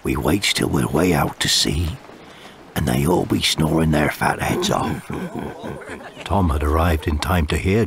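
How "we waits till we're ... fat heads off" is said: villainous pirate. Acted. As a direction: lay out the plot in a low rasp. Narrator voices Cutthroat Jake.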